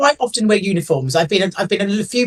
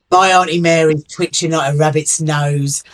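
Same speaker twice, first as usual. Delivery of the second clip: usual speaking voice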